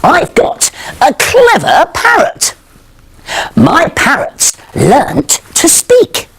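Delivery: bouncy sing-song rhyme for little ones; children's rhyme. Acted. Presenter reciting a rhyme to young children, sing-song. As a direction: recite it brightly to a toddler.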